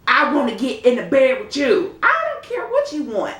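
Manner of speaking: a high, loud mock of a pushy bully; mocking Red the bully. Mocking Red forcing her way into the bed, loud and high. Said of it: "Red just being a big bully".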